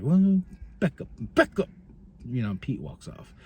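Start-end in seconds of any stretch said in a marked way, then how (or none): 0.0-1.7 back off warning. Retold or imitated. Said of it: "Trick's like".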